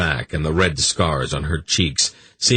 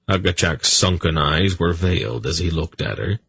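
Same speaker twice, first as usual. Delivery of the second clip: ordinary delivery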